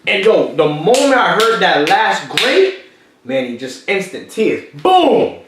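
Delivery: swelling storyteller peak; excited. Real, not acted. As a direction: build to the moment and burst on Boom. Climax of emotional story.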